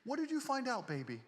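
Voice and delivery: high, fawning mock girlfriend voice; simpering girlfriend. Mocking women who fawn over a rich man, high fawning coo. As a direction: coo it like a fake adoring girlfriend. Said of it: "they sit there and go".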